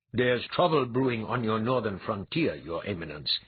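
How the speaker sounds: ordinary delivery, nothing marked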